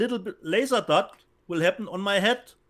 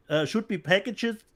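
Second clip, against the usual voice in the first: ordinary talk, nothing marked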